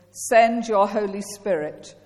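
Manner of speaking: ordinary delivery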